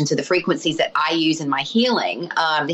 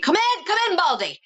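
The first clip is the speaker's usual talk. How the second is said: high-pitched blurted imitation of a verbal tic; imitated Tourette's tic; 13 semitones higher than usual. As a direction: blurt it high, like an involuntary call. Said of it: "she's, like, blurting stuff".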